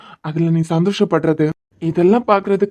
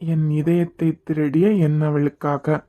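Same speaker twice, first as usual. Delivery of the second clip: poem recital. Acted. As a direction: read it like a tender love letter. Reads a love poem aloud.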